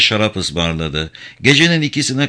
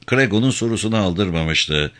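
plain reading voice

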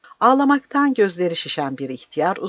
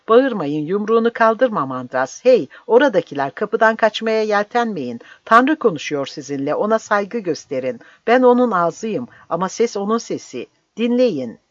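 commanding call; 5 semitones higher, 4 dB louder than usual. A narrator's reading. Priest commands an unruly crowd.

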